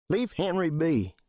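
high, firm order from the doorway; stern younger man. Acted. Bill House warns Smallwood off Henry, stern. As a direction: set him straight.

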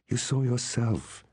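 a touch of pleading; almost pleading. A narrator's reading. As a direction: plead gently, pitch lifted and words drawn out. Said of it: "she said, almost pleading".

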